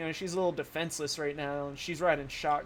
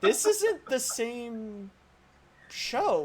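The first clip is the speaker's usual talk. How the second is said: slow, higher, baffled complaint; exasperated viewer; 6 semitones higher, much slower than usual. Retold or imitated. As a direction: drag it out in disbelief. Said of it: "and I'm like".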